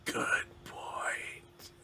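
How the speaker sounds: faint whispered reply; ghostly whisper. Acted. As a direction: concede it in a barely audible spirit whisper. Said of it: "You hear another whisper say".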